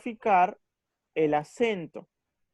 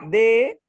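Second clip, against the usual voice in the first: "D" said as a loud, higher "de"; stressed syllable DE; 4 semitones higher, 10 dB louder than usual. Shown as a demonstration. Teacher isolating the accented syllable, a loud stressed "D". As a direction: punch out "D" as the strong syllable "de". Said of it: "la sílaba que lleva la mayor fuerza de voz".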